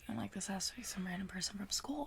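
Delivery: whisper. Real, not acted. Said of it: "hushed storytelling".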